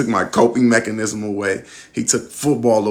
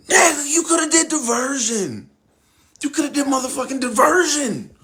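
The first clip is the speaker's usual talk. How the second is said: high, exasperated disbelief; can't believe how dumb it was; incredulous. Retold or imitated. Thinking in disbelief about the informant's choice, exasperated.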